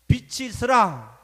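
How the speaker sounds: loud, ringing divine decree; God's command. Retold or imitated. Preacher quotes God creating light, commanding.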